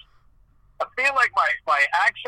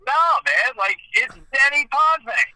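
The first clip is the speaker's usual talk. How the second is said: higher, louder and slower, insisting on "Denny Potvin"; insistent protest; 8 semitones higher, 5 dB louder than usual. Retold or imitated. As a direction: push back hard on the name, dragging it out. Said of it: "I'm like".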